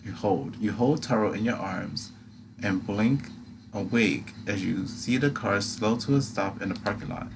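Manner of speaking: ordinary delivery, nothing marked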